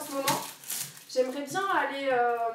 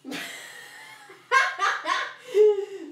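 big laugh; 6 semitones higher, 6 dB louder than usual. Real, not acted. Laughs at own struggle on live.

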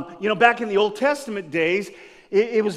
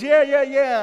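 higher, louder crowd cheer; eager kids cheering; 8 semitones higher, 7 dB louder than usual. Retold or imitated. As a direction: egg him on gleefully. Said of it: "And everybody's".

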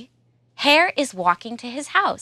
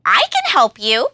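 Monkey offering help; 4 semitones higher, 4 dB louder than usual. Acted. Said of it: "says Monkey".

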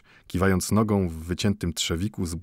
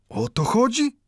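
Mendel, much higher, taken aback; surprised; 3 dB louder than usual. A narrator's reading. Mendel answers the watchmaker, surprised and higher. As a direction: act surprised.

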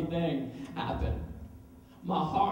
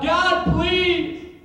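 crying out to God; 5 semitones higher, slower than usual. Acted. Broken man begging God to end it, desperate cry. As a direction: cry out to God, then drag out kill me now. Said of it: "I cried out".

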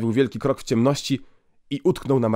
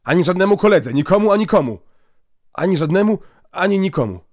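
eager promise; 7 semitones higher, 6 dB louder than usual. Acted. Boy eagerly promises silence.

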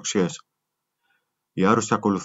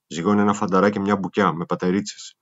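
plain reading voice

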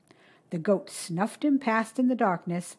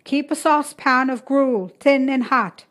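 a raised, brisk mother's answer; 5 semitones higher, 8 dB louder than usual. A narrator's reading. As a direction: call it back over her shoulder, busy. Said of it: "she answered".

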